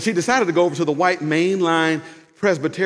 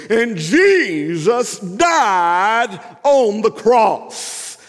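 loud, high, very drawn-out pulpit cry; old preacher; 6 semitones higher, 4 dB louder, much slower than usual. Acted. Elderly preacher keeps on preaching, slow loud proclamation. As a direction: stretch the words out like an old-school preacher. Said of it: "that old man says".

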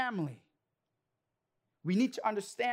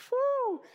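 whistling whew; 13 semitones higher, 4 dB louder than usual. Real, not acted. Reacting to his own temptation.

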